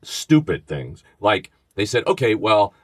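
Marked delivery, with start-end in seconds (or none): none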